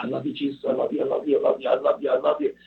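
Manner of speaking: breathless run of repeated prayer; imitating an overwhelmed, gushing worshipper; rapid-fire devotion. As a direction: tumble the words out without pausing. Retold overwhelmed prayer in the bathroom.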